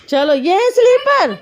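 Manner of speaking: loud, high, drawn-out call. Real, not acted. Mother hurrying child into slippers, calling out loudly. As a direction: call out slowly at high pitch.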